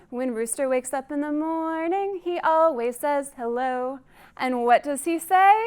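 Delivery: ordinary delivery, nothing marked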